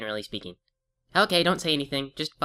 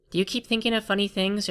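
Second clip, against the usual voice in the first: usual speaking voice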